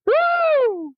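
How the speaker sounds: high, loud woo; excited whoop. Real, not acted. Cheers while reading statement.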